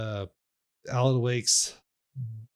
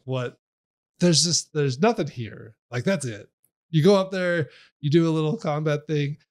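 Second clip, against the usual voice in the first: usual speaking voice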